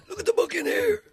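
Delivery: very high mock shriek; hammed-up holler; Gregory Campbell. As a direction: yell it high and loud. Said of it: "for Gregory".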